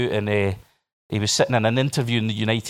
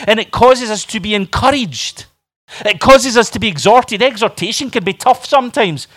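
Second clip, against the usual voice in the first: raised, driving sermon voice; full-force preaching; 9 semitones higher, 8 dB louder than usual. Acted. Preacher rallying the church to unity, full-force preaching. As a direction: press each point home to the congregation.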